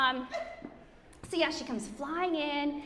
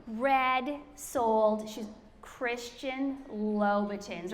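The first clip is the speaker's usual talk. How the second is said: very slow, drawn-out reveal; savoring; much slower than usual. Real, not acted. Speaker revealing her friend's designer shoes to the audience, savoring slowly. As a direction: relish each word, stretching it out.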